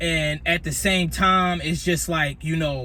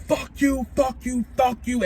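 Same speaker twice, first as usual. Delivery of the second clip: angry yelling; 7 semitones higher than usual. Retold or imitated. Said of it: "So I'm like".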